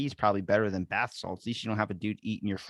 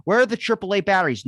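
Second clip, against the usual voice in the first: higher, louder pushy question; lightly voiced shopper; mock customer; 10 semitones higher, 7 dB louder, faster than usual. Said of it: "customer asking in store".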